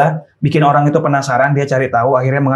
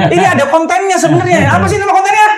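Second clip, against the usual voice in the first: very high hype-up question; high-pitched cue call; 13 semitones higher than usual. Pretend. Host cues the challenge giver for the title, high-pitched call.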